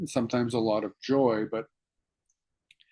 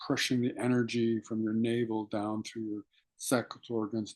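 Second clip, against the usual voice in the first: guided meditation. Acted. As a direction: draw them inward with hushed, patient instruction. Leads breathing meditation.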